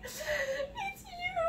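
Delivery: high, soft, tearful confession; crying confession. Retold or imitated. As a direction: choke it out through tears. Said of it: "And, like, I was, like, crying".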